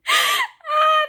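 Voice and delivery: high loud squeal of joy; delighted squeal. Real, not acted. Fan squealing at a reveal, high and loud. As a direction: let out a happy shriek.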